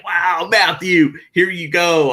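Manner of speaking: high, loud burst of delight; excited exclamation. Retold or imitated. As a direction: relive jumping for joy. Retells jumping for joy at news.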